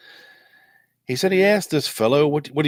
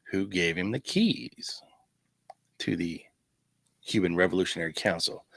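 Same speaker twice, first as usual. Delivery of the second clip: whisper; 4 semitones lower, 5 dB quieter than usual. Real, not acted. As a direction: drop to a whisper on the big question. Conspiratorial question about the keys.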